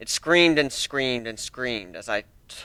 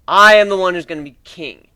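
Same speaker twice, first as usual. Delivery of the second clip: boastful; 4 semitones higher, 11 dB louder than usual. Acted. Griffin boasting his ambition.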